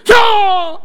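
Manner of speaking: high, explosive kiai shout; sustained loud fighting yell; karate attack cry. Acted. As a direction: yell it like a martial arts strike. Acting out karate attack cries.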